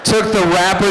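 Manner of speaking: ordinary delivery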